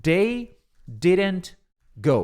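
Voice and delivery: very slow, each word drawn out; slow model phrase. Shown as a demonstration. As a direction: lay the phrase out slowly for students. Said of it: "Se você vê assim".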